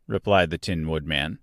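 ordinary delivery

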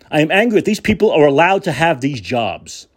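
usual speaking voice

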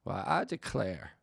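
slow, drawn-out Southern drawl; hammy small-town Southern drawl; old-fashioned Southern drawl. Acted. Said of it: "the language is very old-fashioned".

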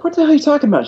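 can't believe what's being asked; exasperated disbelief. Retold or imitated. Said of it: "And I'm like".

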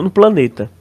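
ordinary talk

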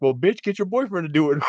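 high mocking comeback; S0 mimics the man's retort, pitched high. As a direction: fire it back as a mock putdown. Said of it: "And he's like".

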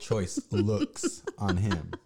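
loud, drawn-out laughing jab; laughing comeback. Real, not acted. Partner joking about her choice words, laughing. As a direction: draw it out loudly through laughter.

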